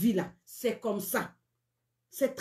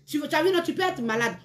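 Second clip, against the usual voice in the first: ordinary talk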